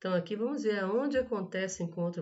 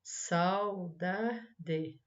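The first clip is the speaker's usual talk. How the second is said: "Saudade" split as sau-da-de; syllable by syllable. Shown as a demonstration. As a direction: sound out "Saudade" in separate syllables. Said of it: "separe-as em sílabas". ("Saudade" separado em sau-da-de)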